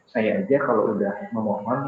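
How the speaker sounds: ordinary talk, nothing marked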